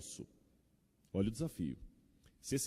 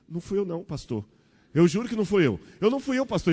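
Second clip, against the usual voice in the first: put-on high, loud panicked child protesting; a frightened boy swearing it wasn't him; the boy blurts out his innocence to the new pastor, high and panicky; scared kid denying; 8 semitones higher, 11 dB louder, faster than usual.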